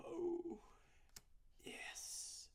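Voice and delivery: excited whisper. Real, not acted. Thrilled by a wanted pull.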